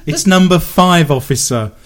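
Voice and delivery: loud, laughing parody of an officer calling the lineup; hammed-up cop barking at a lineup, a touch loud; mock police officer. Host pretends to be a cop at a lineup, loud and laughing.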